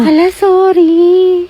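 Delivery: drawn-out sorry. Acted. Slow drawn-out mock apology.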